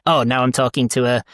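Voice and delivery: usual speaking voice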